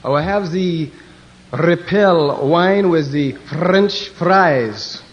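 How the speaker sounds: very slow, mock French accent; hammed-up French accent, slow. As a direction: order wine like a pompous Frenchman. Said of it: "asked the Frenchman".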